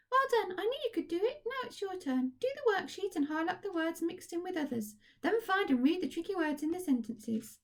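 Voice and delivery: very high, squeaky puppet voice full of cheer; a chirpy puppet praising the children; Kathy puppet voice. Acted. To the class, puppet Kathy cheers them on, squeaky and high.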